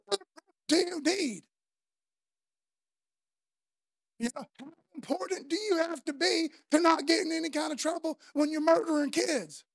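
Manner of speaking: angry rant at injustice; fierce. Retold or imitated. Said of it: "And then I get fierce".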